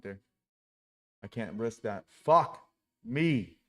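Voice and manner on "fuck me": sudden loud angry curse; blurted out in real frustration. Real, not acted. Trader cursing at a losing trade, angry outburst.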